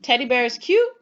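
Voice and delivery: "Teddy bear is cute" as a question, jumping up on "cute"; asking. Shown as a demonstration. Teacher testing pupils on question marks, asking with a rise on "cute". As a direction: lift "cute" high at the end to ask. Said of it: "Am I asking".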